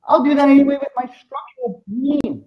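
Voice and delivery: high, slow smug voice; mock smug viewer, high and slow. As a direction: play a cocky user brushing it off. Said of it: "You're like".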